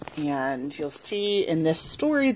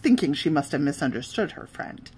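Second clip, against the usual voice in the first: ordinary delivery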